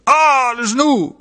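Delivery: high, drawn-out cry of pain; slow, high-pitched outcry. Acted. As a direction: exclaim at a sudden violent pain. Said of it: "et je m'écriai".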